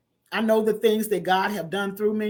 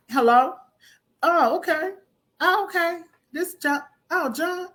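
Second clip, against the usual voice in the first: pitched up, eager voice on the phone; pitched-up eager phone voice, higher than her own; acted phone call; 8 semitones higher than usual. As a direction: play the flattered caller saying yes to everything.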